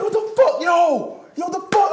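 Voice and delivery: very high, loud disbelieving yell; shocked outraged yell. Retold or imitated. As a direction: scream it like your drink was just slapped away. Can smacked from his hand.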